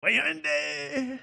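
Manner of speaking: high hammed-up come-on voice; quoting the line. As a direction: squeal the cheesy pickup line. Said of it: "That might be my favorite".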